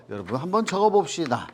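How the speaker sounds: ordinary delivery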